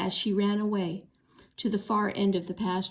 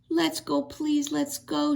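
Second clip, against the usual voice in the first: tearful begging; 7 semitones higher, slower than usual. A narrator's reading. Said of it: "she begged".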